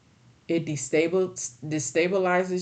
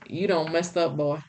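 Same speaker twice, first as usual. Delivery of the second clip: channel the KFC Colonel chewing someone out; old Southern colonel. Retold or imitated. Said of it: "like the K F C Colonel".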